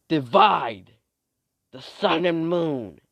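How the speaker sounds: loud, high attack call, drawn out; battle cry. Acted. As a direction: bellow the spell's name slowly. Calling out a power's name in battle.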